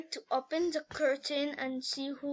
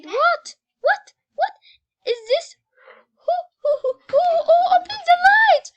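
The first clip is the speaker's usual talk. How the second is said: very high, loud broken shrieks; terrified screaming; 14 dB louder than usual. A narrator's reading. Scared girl when lights come on, terrified screaming. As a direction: shriek it word by word in panic.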